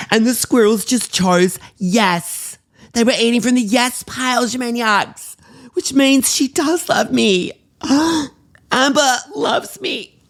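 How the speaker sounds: high, drawn-out elation; thrilled, pitch soaring; overjoyed. Acted. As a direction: squeal it, giddy with love. Learns that Amber loves her.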